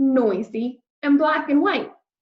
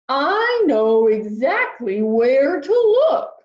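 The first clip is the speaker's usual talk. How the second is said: big, louder whale greeting; friendly whale voice; 4 semitones higher, 5 dB louder, slower than usual. Acted. Whale greeting lost Peter, big and friendly. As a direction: boom out a kindly hello.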